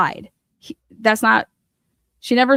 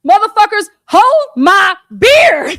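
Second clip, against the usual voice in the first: higher, louder, drawn-out bravado; defiant challenge; 9 semitones higher, 8 dB louder, slower than usual. Retold or imitated. Host daring the detectives, loud and cocky. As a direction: throw down the gauntlet, slow and loud.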